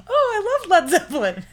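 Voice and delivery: high, loud, quick mock gushing; over-earnest excited imitation. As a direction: blurt it like an eager fanboy. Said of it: "in, like, such an earnest way".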